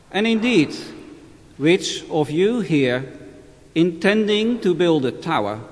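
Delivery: measured solemn scripture reading; Gospel reading. Acted. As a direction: read it like a priest at Mass. Formal Gospel reading at Mass.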